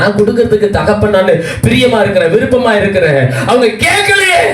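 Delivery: acted divine appeal rising to a very high cry; impassioned lament. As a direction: build from eager offer to high-pitched lament. Preacher voices God's rising lament. (ஆதங்கத்தோடு உயர்ந்து செல்லும் குரல்)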